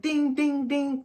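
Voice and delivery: high, loud ding ding ding like a buzzing phone; phone ding imitation. Imitating her phone blowing up with texts, high mock dings. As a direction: mimic the text alert sounds.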